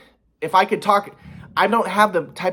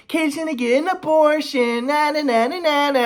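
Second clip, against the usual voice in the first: high sing-song taunt; mock him like a teasing kid; taunting mom voice; 12 semitones higher than usual. Son imitates mom taunting him, high sing-song. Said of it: "She's like".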